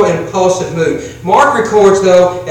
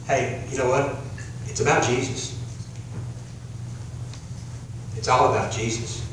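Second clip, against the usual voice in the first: soft aside; 6 semitones lower, 13 dB quieter than usual. Real, not acted. Soft intimate aside to congregation.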